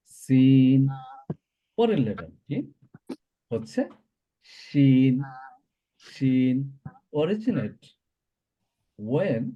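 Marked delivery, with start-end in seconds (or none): 0.1-0.9 "সিন" with a sibilant whistling S, loud and drawn out; hissing S. Shown as a demonstration. Teacher models the letter for students, "সিন" with hissing S. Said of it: "তাহলেই আমরা pronounce করতে পারবো". 4.4-6.6 "শিন" with a full hushing SH, drawn out evenly; mid-tongue to palate for the SH in "শিন". Shown as a demonstration. Teacher models the next letter, "শিন" held long with SH. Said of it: "মধ্যখানটা".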